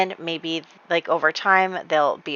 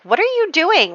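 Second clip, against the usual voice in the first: mock yell at him from the bridge; retold shout; 10 semitones higher than usual. Woman on the bridge calls to the man in the water, high mock yell. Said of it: "like, shouts to him".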